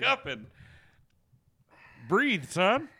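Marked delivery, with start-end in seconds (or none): 2.1-2.9 loud high coaching shout; loud call. Real, not acted. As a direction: shout at him to breathe. Calling to hiccuping friend.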